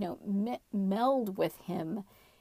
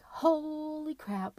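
long, drawn-out higher "holy"; stunned disbelief; 9 semitones higher, much slower than usual. Retold or imitated. Frightened sleeper reacting to whispering voices, drawn-out disbelief. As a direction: draw it out slowly in mock astonishment.

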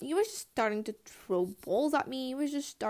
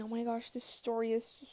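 usual speaking voice

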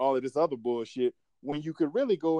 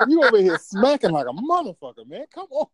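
laughing; 8 semitones higher, 9 dB louder than usual. Real, not acted. Teasing about loud chewing.

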